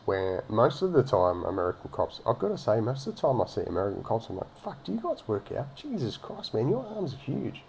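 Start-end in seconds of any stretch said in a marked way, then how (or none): 4.6-7.6 high, soft voice of lightly acted awe; a touch of hammed-up wonder; mock amazement. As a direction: marvel at someone's size, pitched up and hushed. Acts out amazement at muscular cops.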